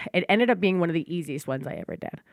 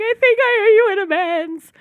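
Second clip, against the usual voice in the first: high, loud sheepish mock voice; hammed-up nervous confession; imitating herself; 7 dB louder than usual. Telling the stepmother she owes amends, high mock voice. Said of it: "I'm like".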